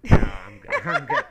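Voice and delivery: squeaky laughing refusal. Real, not acted. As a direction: laugh it out high.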